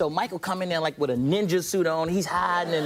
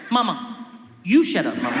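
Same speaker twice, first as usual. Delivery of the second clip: talking back; 5 dB louder than usual. Retold or imitated. Acts himself talking back to mother.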